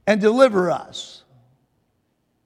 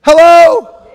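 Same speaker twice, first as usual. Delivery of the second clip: loud, higher questioning call; raised-voice prod to the congregation; 4 semitones higher, 9 dB louder than usual. Real, not acted. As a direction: holler for the crowd's response. Calling to congregation for a response.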